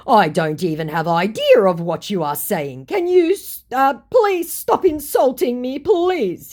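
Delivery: rising to a high, loud plea; exasperated pleading. Retold or imitated. Scammer begging his accuser to stop insulting him, exasperated pleading.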